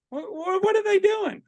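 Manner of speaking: mock alarmed guest voice; bewildered guests. Guests startled when strangers join in, high baffled mock. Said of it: "my guests are normally like".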